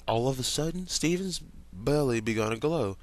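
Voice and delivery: ordinary delivery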